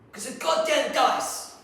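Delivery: loud, high, drawn-out cursing; exasperated outburst. Acted. Psychologist blaming the dice for his anguish, exasperated. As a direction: explode slowly on every word in frustration.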